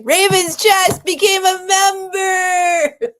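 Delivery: loud, high, tearful, slow announcement; crying shout. Real, not acted. Still in tears, announcing a new member to viewers. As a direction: cry it out loud while announcing. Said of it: "I'm gonna cry right now".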